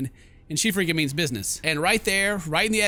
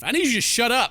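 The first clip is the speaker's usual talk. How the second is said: higher, exasperated cut-in; exasperated outburst; 6 semitones higher, 4 dB louder than usual. Acted. Narrator cutting off the rambling, exasperated. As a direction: lose patience and raise the pitch.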